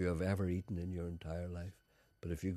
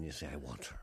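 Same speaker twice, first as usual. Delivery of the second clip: low lustful murmur; 7 semitones lower, 11 dB quieter than usual. Retold or imitated. Quoting lustful aside.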